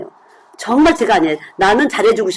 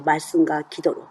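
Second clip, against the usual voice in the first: soft echo; 6 semitones lower, 7 dB quieter than usual. Shown as a demonstration. Preacher echoes phrase softly.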